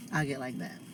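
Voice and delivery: usual speaking voice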